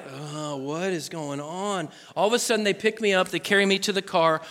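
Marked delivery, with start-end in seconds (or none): 0.1-1.9 softer and slow, groggy confusion; a groggy boy waking after a knockout; dazed. Retold or imitated. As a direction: come to, not knowing where you are. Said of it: "And I'm just like".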